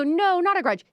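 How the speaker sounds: imitating producers, high and loud; a squeaky, fussy producer protesting; high mock producer. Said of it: "My producers go".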